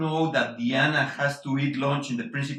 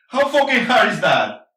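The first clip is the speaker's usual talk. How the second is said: angry; 4 semitones higher, 6 dB louder than usual. Acted. Father ranting about son.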